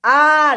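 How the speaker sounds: loud, high acted scream; high yell of alarm. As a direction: scream "ah" as you're thrown about. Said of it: "they screamed".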